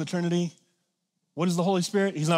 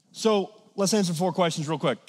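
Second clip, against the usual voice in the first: ordinary talk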